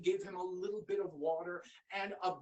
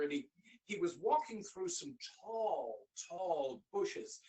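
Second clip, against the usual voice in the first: plain reading voice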